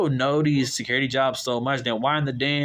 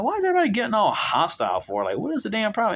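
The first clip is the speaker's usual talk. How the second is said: higher and fast in exasperation; exasperated; 8 semitones higher, faster than usual. Retold or imitated. Asking the hostile customer what his problem is, higher and fast. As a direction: rattle it off, fed up and baffled.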